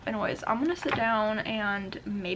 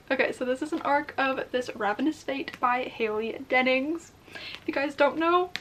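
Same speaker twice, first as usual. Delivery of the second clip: ordinary talk, nothing marked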